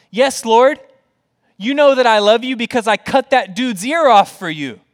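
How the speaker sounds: high, loud bragging to the Lord; boastful. Acted. Imagined Peter boasting to Jesus of his loyalty, boastful. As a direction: proclaim your own feat proudly and loudly. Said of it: "Peter could have boasted to Jesus".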